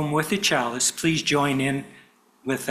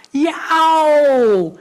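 sharp, loud, high cry of pain; high pained yelp; 12 semitones higher, 10 dB louder than usual. Acted. Baby Bear burns his paws, yelping out loud. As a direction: yelp like a burnt cub. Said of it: "Baby Bear screams".

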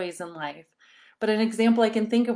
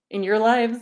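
ordinary talk, nothing marked

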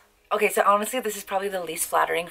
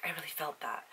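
hushed, softer mock-earnest "I really felt that"; mock moved hush; 3 semitones lower, 14 dB quieter than usual. Mocking a teary movie viewer, soft hushed earnest. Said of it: "people will be like".

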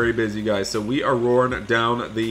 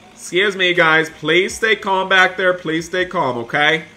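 louder, higher call for calm; loud driver plea for calm; calling to back seats; 6 semitones higher, 5 dB louder than usual. Pretend. Calming rowdy students.

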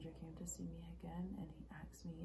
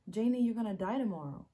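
loud, raised-pitch quote; urgent, emphatic warning; dramatic retelling; 4 semitones higher, 11 dB louder than usual. Acted. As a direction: quote the stranger forcefully. Acted monologue, emphatic second take.

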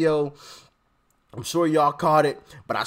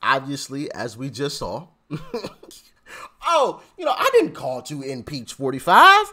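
normal talking voice

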